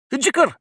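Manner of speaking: sudden high squawked cry; a creature's strange yelp; odd exclamation. A narrator's reading. Griffin breaking the silence, high squawk. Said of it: "occasional exclamation of".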